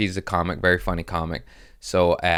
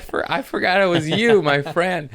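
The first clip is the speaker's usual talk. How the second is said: high, comically pleading retelling; mock dismay; 12 semitones higher, 4 dB louder than usual. Realizing the dropped opener was a friend, mock dismay. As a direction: push it up high like a hammed-up apology.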